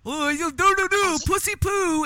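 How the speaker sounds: high, slow sing-song fake whimper; mocking baby talk. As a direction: coo it like a faking baby. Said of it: "He's gonna fake being".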